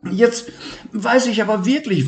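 high and fast; eager idea. Acted. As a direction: rush out the plan. Levi new idea.

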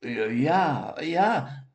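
rising timid stammer; stammering fearfully. Acted. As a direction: stammer out of fear. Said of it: "stammelte Siggi etwas ängstlich".

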